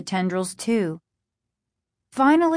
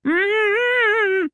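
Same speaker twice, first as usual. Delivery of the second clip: long, high, pleading "mmm"; muffled pleading hum; 13 semitones higher, 3 dB louder than usual. A narrator's reading. Bound father begging his kids for help, muffled pleading. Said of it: "their father continued to plead".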